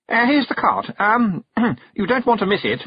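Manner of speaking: high, loud, hesitant urging; flustered. A narrator's reading. Young noble changing the subject, flustered. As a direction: blurt it out, clearing the throat awkwardly. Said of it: "made Roland blush and stutter".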